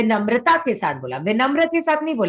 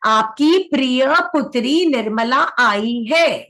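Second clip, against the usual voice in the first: slow dictation; slower than usual. Shown as a demonstration. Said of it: "याद रहेगा।".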